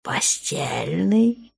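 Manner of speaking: slow soft whisper, no voice; purring whisper. A narrator's reading. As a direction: purr it suggestively. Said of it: "промурлыкал". (мурлыкающий шёпот)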